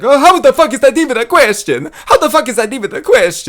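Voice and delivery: put-on high screamed Italian accent; Marvin Vettori impression. Comic imitating fighter at press conference, screaming Italian impression. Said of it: "but screaming".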